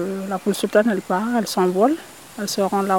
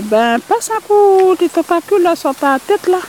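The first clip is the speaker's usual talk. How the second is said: voix de rat plus haute et moqueuse; rat narquois; 10 semitones higher, 5 dB louder than usual. Acted. Le rat nargue le poulpe. (le rat nargue le poulpe, plus haut et plus fort)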